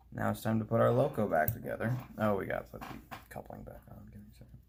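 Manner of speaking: ordinary talk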